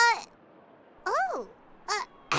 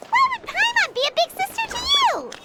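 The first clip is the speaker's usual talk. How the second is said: much higher, incredulous question; baffled; 10 semitones higher than usual. Acted. Paimon baffled at being called big sister, incredulous squeak. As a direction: squeak out the disbelief.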